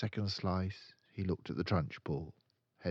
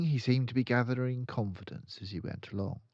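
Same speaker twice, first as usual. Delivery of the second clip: usual speaking voice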